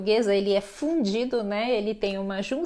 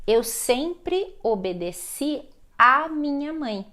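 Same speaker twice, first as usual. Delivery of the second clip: slow, deliberate teacher's dictation; slow example sentence; 3 semitones higher, slower than usual. Shown as a demonstration. Teacher showing students an example with obedecer, slowly and deliberately. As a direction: model the sentence slowly, word by word.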